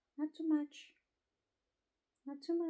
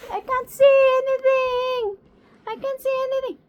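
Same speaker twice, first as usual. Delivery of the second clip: high, loud playful peekaboo voice; 10 semitones higher, 13 dB louder than usual. Pretend. Owner teasing her bird, high and loud. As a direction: tease the bird in a raised voice.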